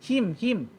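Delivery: Him him softly with a clear i; correct him. Shown as a demonstration. Teacher models the correct him. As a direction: say him lightly, not hem. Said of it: "jangan baca him".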